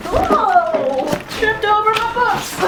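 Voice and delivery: high, loud startled whoa into laughter; startled yelp. Real, not acted. Vlogger tripping over books mid room tour, laughing surprise. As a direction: cry out as you stumble, then laugh.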